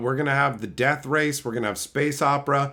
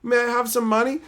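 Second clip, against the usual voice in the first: mock begging plea; 10 semitones higher than usual. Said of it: "Begging is when you're like".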